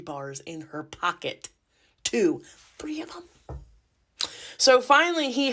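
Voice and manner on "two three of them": hushed unvoiced aside; whispered aside. Real, not acted. Telling the audience about the candy, a whispered aside. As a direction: whisper it to the crowd.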